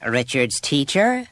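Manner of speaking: "Richard's teacher?" slow and high, climbing at the end; rising note. A narrator's reading. Mother answering the teacher's call, "Richard's teacher?" on a rising note. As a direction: lift "teacher" into a drawn-out question. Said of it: "ended on a rising note".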